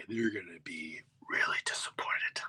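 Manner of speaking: soft whispered dread. Retold or imitated. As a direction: confess it under your breath. Dread before walking out.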